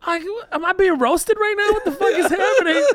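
high laughing disbelief; baffled, laughing through it. Retold or imitated. Retold own bafflement, laughing.

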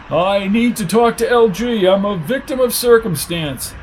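high, plaintive character voice; self-pitying suspect. Acted. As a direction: protest like a cornered weakling.

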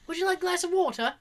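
put-on fast, a touch of British accent repeating the offer; British stewardess, repeated. Stewardess repeating water offer, quick British accent. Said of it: "the woman repeats herself".

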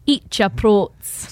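slow, hammed-up Scottish brogue; drawn-out Scottish command, eat your Prote's; mock Scottish accent. Cohost riffing on brand name, slow mock Scottish accent. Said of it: "say it with a Scottish accent".

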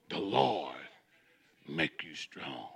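low, soft and slow; whispered slow scripture; hushed reading. Acted. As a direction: drop to a near hush on the promise. Quiet reverent reading.

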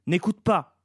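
high, loud urgent cry; shouted order. A narrator's reading. Shea warns her son against the creature, shouting. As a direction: shout over the creature to stop him listening. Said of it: "lui cria Shea".

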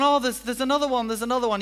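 fast, high, eager insisting; eager giver. Retold or imitated. Giver urging the last gift on a tired guest, high and quick. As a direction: keep pushing the extra gift on them.

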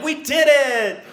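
high, loud victory cry; triumphant cheer. Retold or imitated. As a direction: celebrate it loudly. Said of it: "we were like".

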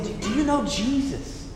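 hushed, earnest question; soft personal appeal. Acted. Preacher acting out asking somebody about faith, softly and personally. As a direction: drop the voice as if asking one person directly. Voicing one-on-one witnessing to someone.

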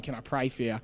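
soft, unsure offer to pray; mate nervously offering to pray on site, quiet; hesitant quiet ask. Retold or imitated. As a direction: ask it almost under your breath. Timid offer to pray at smoko.

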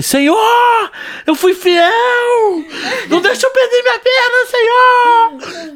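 very high, loud mock prayer wail; retold fervent screaming. Injured churchgoer pleading with God for his leg, screamed plea. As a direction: cry out to God, hammed-up desperation. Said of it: "eu comecei a gritar".

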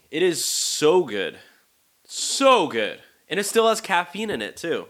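high, loud enthusiasm; excited raving. Real, not acted. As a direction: rave about it with real delight. Raving about the amazing tea.

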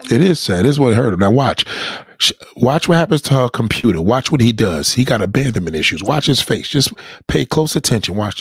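loud, eager play-by-play of his face; loud gleeful narration. Real, not acted. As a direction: call out every move to the audience. Loudly telling chat to watch.

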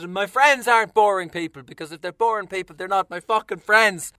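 ordinary talk, nothing marked

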